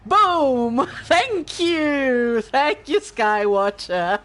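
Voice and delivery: loud, high, triumphant outburst with laughter; delighted cheer. Real, not acted. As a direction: cheer loudly, tickled by the comeback. Streamer cheers favorite game exchange.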